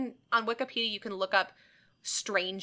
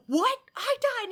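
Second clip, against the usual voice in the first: much higher, startled squeak; shocked patient; 4 dB louder, slower than usual. Retold or imitated. Retelling the movie patient learning he died, high shocked. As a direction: yelp it in disbelief. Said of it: "And he's like".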